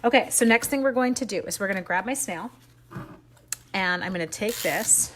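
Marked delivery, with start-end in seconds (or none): none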